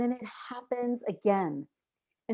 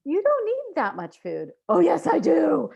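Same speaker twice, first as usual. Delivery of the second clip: mock inner argument; 8 semitones higher, 6 dB louder than usual. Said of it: "inner dialogue voiced".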